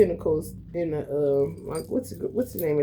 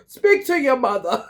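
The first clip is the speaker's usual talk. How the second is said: mock Italian father; 5 semitones higher, 6 dB louder than usual. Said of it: "The father all the time".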